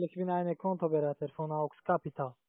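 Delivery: normal talking voice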